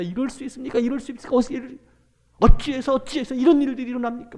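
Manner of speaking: high, loud panicked outcry; frantic disciples. Acted. Disciples waking Jesus in the storm, frantic high outcry. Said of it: "얘기합니다".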